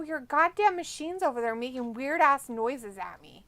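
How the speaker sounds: usual speaking voice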